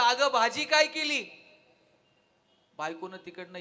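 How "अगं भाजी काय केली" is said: calls out loud and slow from the door; calling from the door. Retold or imitated. Husband at the door calls out to his wife, loud and slow. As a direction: call across the house to the wife. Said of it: "यानं दारातूनच आवाज दिला".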